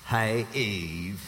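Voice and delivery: low, smooth pickup voice; pickup line voice. Retold or imitated. Adam greets Eve with a low smooth pickup voice. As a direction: play the suave flirt. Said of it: "You think he really changed his voice and talked like that?".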